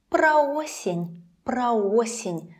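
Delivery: "Про осень" slowly, as "pra-O-sen"; a in про, then full stressed о in "осень"; stressed o after pra. Shown as a demonstration. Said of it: "o is stressed in the word осень".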